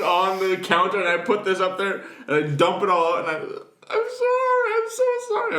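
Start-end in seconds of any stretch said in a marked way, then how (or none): 3.4-5.6 mock weeping. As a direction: ham up a tearful little kid's apology. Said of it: "I'm just weeping".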